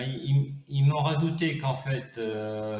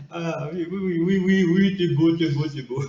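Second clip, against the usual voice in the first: put-on cooing baby talk; 3 semitones higher, 6 dB louder than usual. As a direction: coo at the fluffy creature, delighted. Praising the pet.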